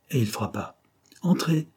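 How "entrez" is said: called out. A narrator's reading. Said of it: "cria la voix".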